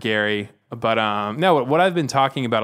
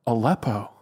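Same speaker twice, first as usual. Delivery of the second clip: lightly voiced, blank and puzzled "Aleppo?"; a hint of a baffled candidate; Gary Johnson impression. Candidate asking what Aleppo is, lightly imitated bafflement. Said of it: "And that's what he sounded like".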